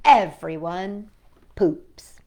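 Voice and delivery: ordinary talk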